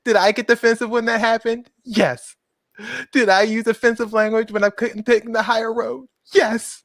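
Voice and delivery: high, theatrical self-questioning; mock-earnest public statement; mock-solemn apology. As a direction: pose and answer like a hammed-up confession. Theatrical reading of the woman's apology.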